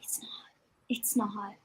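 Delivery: breathy hushed it's not; whispered agreement. Real, not acted. Friend whispering agreement about a bad book, hushed.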